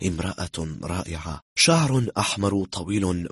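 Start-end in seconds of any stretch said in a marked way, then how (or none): none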